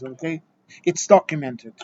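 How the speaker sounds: usual speaking voice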